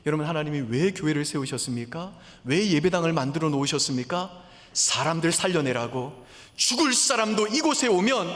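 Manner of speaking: 높고 크게 외치듯 설교; 목소리를 높여 절박하게 호소하기; impassioned preaching. Acted. Preaching at full force. (열정적으로 외치는 설교)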